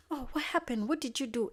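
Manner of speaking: acted high, loud fussing mom; alarmed mom. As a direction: fire off alarmed questions as a mother. Acting the worried mom's questions.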